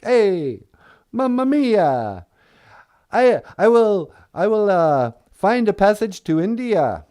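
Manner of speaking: high, loud cartoon Italian accent. Columbus promising passage to India, broad Italian accent. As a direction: ham up a clumsy Italian accent. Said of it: "totally stereotypical Italian accent".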